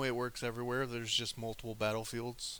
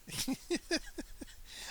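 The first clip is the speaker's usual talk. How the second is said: breathy laugh, pitched up; real laugh breaking out; high airy laugh. Real, not acted. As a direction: let out a light airy laugh.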